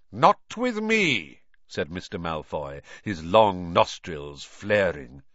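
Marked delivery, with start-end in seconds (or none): 0.1-1.4 higher, louder proud declaration; proud aristocrat's rebuff; haughty. A narrator's reading. Malfoy's proud retort.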